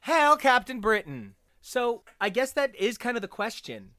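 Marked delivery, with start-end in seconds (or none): 0.0-1.3 hammed-up hail. Echoing co-host's salute, louder and hammed-up. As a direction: belt out a mock-grand hail.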